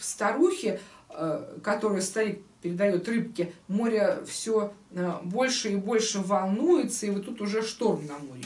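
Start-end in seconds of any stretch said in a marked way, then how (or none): none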